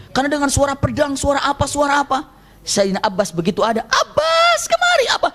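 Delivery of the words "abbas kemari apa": very high summoning call; urgent call. Retold or imitated. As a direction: call Abbas over the din. Prophet calling Abbas in battle.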